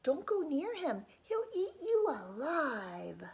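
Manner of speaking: loud, high warning, slowing at the end; yelled warning. Acted. Villagers warning the boy off the giant, loud frightened shout. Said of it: "The people yelled".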